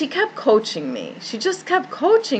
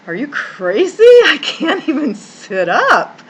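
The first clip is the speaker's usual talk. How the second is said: laughing disbelief; 6 semitones higher, 6 dB louder than usual. Retold or imitated. Her own disbelieving thought quoted.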